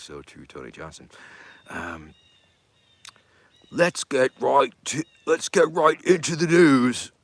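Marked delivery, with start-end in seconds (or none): 3.7-7.1 loud, high, slow Australian shout; Australian accent. Shown as a demonstration. Streamer performs a fan's request, loud Australian accent. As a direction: belt it out in a broad amateur Australian accent. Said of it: "what I got for an Australian accent".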